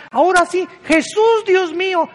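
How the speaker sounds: agudo y fuerte, grito de pánico; clamor asustado. Retold or imitated. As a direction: imita a los que claman en el temblor. Imita a la gente aterrada clamando. (clamor de pánico imitado)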